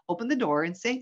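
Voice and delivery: ordinary talk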